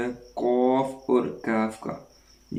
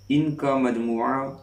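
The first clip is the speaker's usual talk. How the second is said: ordinary delivery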